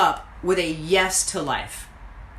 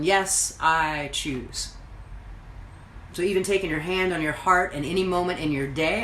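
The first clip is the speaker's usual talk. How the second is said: normal talking voice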